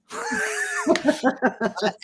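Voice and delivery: high, whooping laugh; real laugh after telling the story; burst of laughter. Real, not acted. Laughing at her own retold story.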